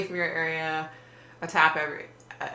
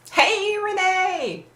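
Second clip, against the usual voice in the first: sing-song greeting; 4 dB louder, much slower than usual. Real, not acted. Greeting a viewer in chat.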